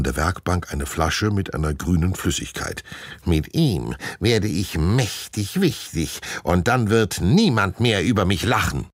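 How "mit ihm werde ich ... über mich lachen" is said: power-hungry villain. A narrator's reading. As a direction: boast with mounting force. (größenwahnsinniger Schurke)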